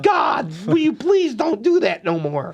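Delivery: mock exasperated plea. Dealer pleading at slow player.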